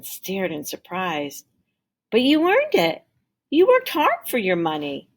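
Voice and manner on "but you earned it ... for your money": higher, louder startled protest; surprised protest. Acted. Can man refuses Tim's coins, higher startled protest. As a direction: push the gift back in disbelief.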